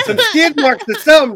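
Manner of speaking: excited. Real, not acted. Excited joining friends' banter.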